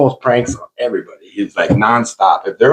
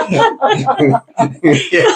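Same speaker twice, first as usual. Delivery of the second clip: high, helpless laugh trailing into yeah; burst of laughter. Real, not acted. Laughing at Donnie's fall.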